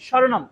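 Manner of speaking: স্বরনাম said "shoronom", with sh; palatal sh. Shown as a demonstration. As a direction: start স্বরনাম with tongue near the palate. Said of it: "touch করতেছে কিনা দেখেন". (স্বরনাম-এর শুরুতে তালব্য শ)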